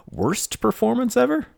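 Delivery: squeaky exaggerated jab.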